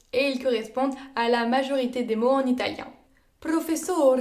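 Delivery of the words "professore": "Professore" with SO stressed; penultimate stress. Shown as a demonstration. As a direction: lean on the next-to-last syllable of "Professore". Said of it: "accentués sur l'avant-dernière syllabe". (« Professore » accentué sur l'avant-dernière syllabe)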